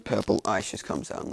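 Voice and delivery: ordinary delivery, nothing marked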